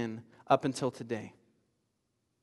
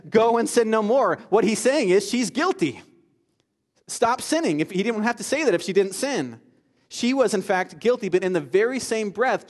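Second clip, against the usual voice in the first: higher, louder impassioned sermon delivery; full-force preaching; 5 semitones higher, 5 dB louder than usual. Acted. Pastor pressing guilt and grace on the congregation, impassioned. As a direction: preach it out to the back row.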